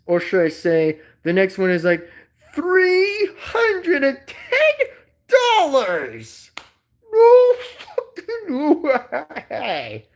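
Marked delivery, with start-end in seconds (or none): 2.5-10.0 slow, high squeal of disbelief; hammed-up squealing incredulity; mock shock. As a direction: wail each word out in outrage. Mock shock at a toy's price.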